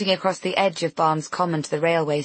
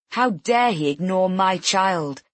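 ordinary delivery

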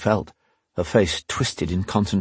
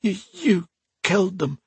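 broken prisoner; 12 semitones higher than usual. Acted. Tortured prisoner accuses.

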